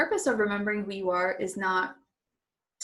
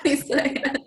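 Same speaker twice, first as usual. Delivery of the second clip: laughing teasingly; 5 semitones higher, 4 dB louder than usual. Real, not acted. Teasing her about crying on camera.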